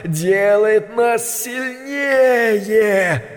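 high, slow, otherworldly whisper of a spider; eerie spider voice. A narrator's reading. Spider speaking to the rats, slow and high. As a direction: drag it out like a creeping spider. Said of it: "произнёс голос паука".

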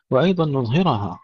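normal talking voice

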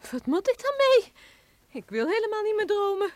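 high and loud protest; upset outcry. Acted. Housekeeper refusing the professor's machine, loud upset protest. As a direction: cry out, you want none of it.

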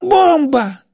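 high, loud wail of alarm; panicked scream. A narrator's reading. Old woman sees a threat in the box, panicked scream. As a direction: shriek the warning in terror. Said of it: "завопила Глафира Митрофановна не своим голосом".

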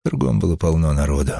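plain reading voice

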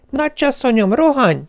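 high, sustained urgent call; alarm cry. A narrator's reading. Maid summons the mistress to the phone, crying alarm. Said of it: "Hallottam vészjelző kiáltását".

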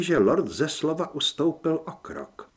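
plain reading voice

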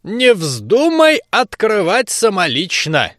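slow, high granny scolding; stern old woman warning. Acted. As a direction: wag a finger like a crone. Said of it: "напутствовала бабка". (строгое напутствие бабки)

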